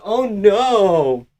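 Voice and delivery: high, drawn-out mocking "oh no"; hammed-up high-pitched worry; mock dismay. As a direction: mimic a fighter's helpless dismay. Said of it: "He's like".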